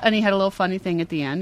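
usual speaking voice

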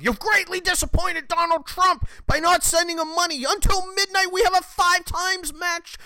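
hammed-up desperate donation plea; mocking fundraising text. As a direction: parody a nagging campaign text in a shrill, high whine. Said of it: "something along the lines of".

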